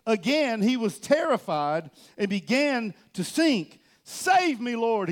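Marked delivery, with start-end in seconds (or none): 4.0-5.1 higher, louder cry for help. Acted. Peter sinking in the water shouts to Jesus for help. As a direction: call out while sinking. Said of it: "he shouted".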